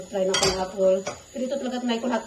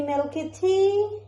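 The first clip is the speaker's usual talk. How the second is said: high, very slow rising coo; sing-song mommy voice; 9 semitones higher, much slower than usual. Real, not acted. Mother offers baby milk.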